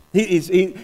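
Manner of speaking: ordinary delivery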